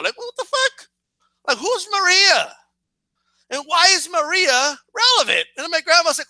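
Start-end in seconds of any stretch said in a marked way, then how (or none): 0.2-2.5 very high, exasperated question; incredulous bafflement. Retold or imitated. Viewer cheated by the cliffhanger, high incredulous protest. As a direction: voice leaps up in comic disbelief.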